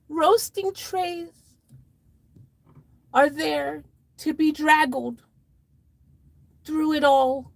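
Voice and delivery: high, very slow character voice; exclaiming in horror. Acted. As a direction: draw it out high in theatrical horror. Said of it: "she exclaimed in sudden horror".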